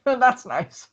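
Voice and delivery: high, strained politeness; through gritted teeth. Acted. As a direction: force a smile through your teeth. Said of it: "grinds his teeth and goes".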